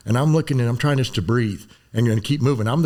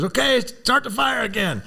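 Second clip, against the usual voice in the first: much higher, slow, drawn out order; drill sergeant order; 13 semitones higher, slower than usual. Retold or imitated. Sergeant walks off and orders live fire resumed, drawn out. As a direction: call it out casually as he walks away. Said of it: "and he goes".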